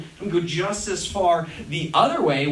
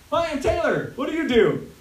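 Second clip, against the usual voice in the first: higher-pitched small-talk voice; mock chirpy greeting; 7 semitones higher than usual. Meeting someone new and asking their job, mock chirpy greeting. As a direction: introduce yourself with put-on perkiness.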